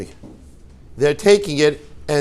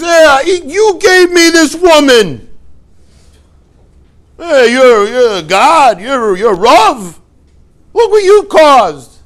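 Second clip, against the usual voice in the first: loud, high-pitched accusation, hammed up; mock blaming; 9 semitones higher, 9 dB louder, slower than usual. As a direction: hurl the blame in a raised voice, drawing out the last words. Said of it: "who does he blame".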